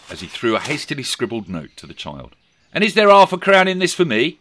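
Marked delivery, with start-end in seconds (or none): 2.7-4.4 put-on cheeky boy voice. A narrator's reading. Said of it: "inquired the enterprising scamp".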